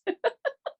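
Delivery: sudden high giggle; high laugh. Real, not acted. Picturing bringing the man toilet paper, a high giggle. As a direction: laugh at the thought.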